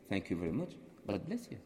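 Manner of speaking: soft, low, gentle thanks; hushed, kindly well-wishing; said with a smile. Retold or imitated. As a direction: smile gently through a quiet blessing. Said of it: "With a smile, and they loved it".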